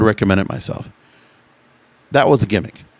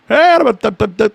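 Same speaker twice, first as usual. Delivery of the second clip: carnival barker call; 9 semitones higher than usual. Retold or imitated. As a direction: bark it out like a fairground huckster. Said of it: "calling it a carnival barker".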